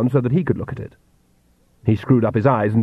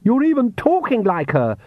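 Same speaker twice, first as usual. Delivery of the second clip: higher-pitched, indignant outburst; mild shout; 11 semitones higher than usual. A narrator's reading. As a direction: cry it out in a raised pitch. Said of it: "shouted Lacrimosa".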